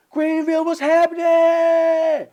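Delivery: high, slow drawn-out hype call; mock emcee shout; hype host shout. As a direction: work the crowd like an emcee. Host hyping crowd.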